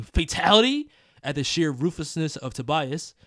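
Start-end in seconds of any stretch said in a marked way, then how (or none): 0.0-0.9 shout. Retold or imitated. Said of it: "might even leave you shouting".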